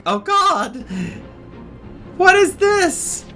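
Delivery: ordinary delivery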